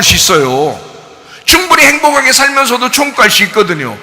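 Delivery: shouted, high, fervent sermon; full-force preaching. Acted. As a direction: preach it at the top of your voice.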